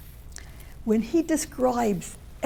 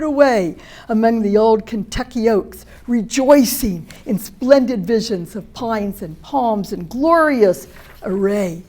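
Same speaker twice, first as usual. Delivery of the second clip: grand declaiming reading; 6 dB louder than usual. Acted. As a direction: proclaim it like a rapturous nineteenth-century naturalist. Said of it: "So that's the way he talked".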